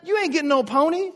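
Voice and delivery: loud, high, exasperated refusal; shutdown parent. Retold or imitated. Parent refusing a child's pony, loud and exasperated. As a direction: shut the kid down hard. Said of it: "the shutdown mode".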